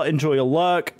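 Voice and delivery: usual speaking voice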